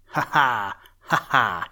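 loud teasing ha ha from outside; mocking laugh. A narrator's reading. The bird boy mocking the old rabbit from the window, laughing loud. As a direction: tease him with a cheeky laugh. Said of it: "he went again".

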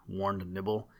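ordinary delivery, nothing marked

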